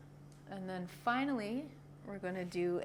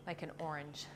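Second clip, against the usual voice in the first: whispering; 6 semitones lower, 7 dB quieter than usual. Acted. Whispered simile in instruction.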